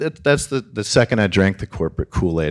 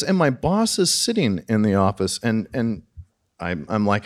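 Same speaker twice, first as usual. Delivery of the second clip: ordinary delivery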